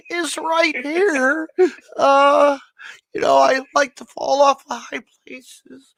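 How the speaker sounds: high, slow, dopey voice of a wrestler; Mick Foley impression. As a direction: play the sweet, odd wrestler, drawn out high. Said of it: "can you do his voice?".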